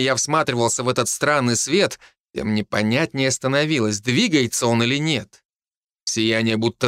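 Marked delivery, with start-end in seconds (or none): none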